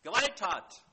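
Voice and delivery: loud, high shouted plea; crying out. Retold or imitated. Preacher crying out to God, loud shout. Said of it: "zu Gott zu schreien".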